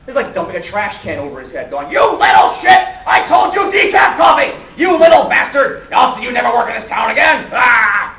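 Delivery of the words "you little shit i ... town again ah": high, loud hammed-up tirade; exaggerated bullying boss. Publisher bullying the kid photographer, exaggerated furious tirade. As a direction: roar abuse like an over-the-top publisher. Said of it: "Very exaggerated stuff".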